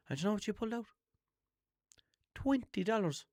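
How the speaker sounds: high, incredulous build to the reveal; indignant disbelief. Real, not acted. Telling listeners about the clerk, high and incredulous. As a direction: scoff at how little it was.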